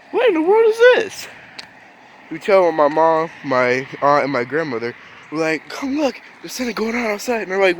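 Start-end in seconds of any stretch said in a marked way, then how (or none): 0.1-1.3 high-pitched, louder mock astonishment; startled disbelief. Startled kids seeing cameras outside. 5.7-7.4 high, quick re-enacted urgency; excited calling over. Child urgently calling family to look.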